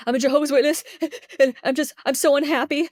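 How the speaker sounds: high, gasping mock crying; imitated hyperventilating sobs. Student confessing to counselor, imitated tearful gasping. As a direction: act out blurting through sobbing breaths. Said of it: "started hyperventilating".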